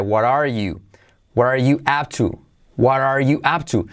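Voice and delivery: "what are" run together as "wa-rar" before "you"; T as soft R. Shown as a demonstration. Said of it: "T como R suave". ("what are you" con la T como R suave)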